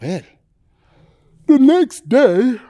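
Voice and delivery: plain reading voice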